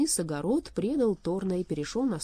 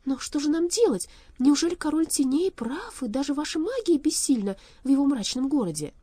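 higher, louder, worried questioning; anxious pleading; 9 semitones higher, 6 dB louder than usual. A narrator's reading. Worried girl asks for help.

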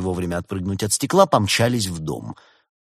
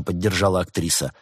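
ordinary delivery

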